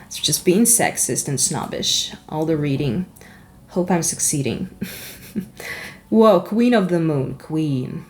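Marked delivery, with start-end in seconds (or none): none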